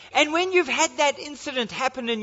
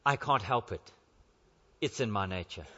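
much lower, much softer scorpion voice; sly scorpion; 10 dB quieter than usual. Acted. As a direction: shrug it off, low and quiet, as the scorpion. Scorpion answers the dying frog.

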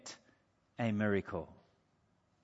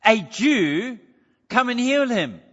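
challenging question; 4 semitones higher, 7 dB louder, slower than usual. Retold or imitated. Preacher voicing Jesus challenging the centurion, loud and pointed. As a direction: put the question to him pointedly, as a dare. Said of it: "he's saying".

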